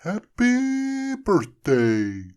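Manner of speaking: very slow, high, loud snowman greeting. A narrator's reading. Frosty speaking as the hat returns. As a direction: draw the words out joyfully. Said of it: "said Frosty again".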